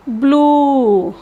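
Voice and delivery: "blue" with the oo drawn out, falling; long U. Shown as a demonstration. Teacher contrasting example words, long U. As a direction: stretch the vowel of "blue". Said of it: "with a length U".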